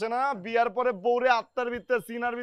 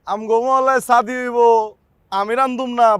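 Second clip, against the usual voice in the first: angry outburst; 3 semitones higher, 10 dB louder than usual. Acted. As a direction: hammer each word out in rage. Angry outburst about the wedding. (জোরে, ধীরে রাগী ঘোষণা)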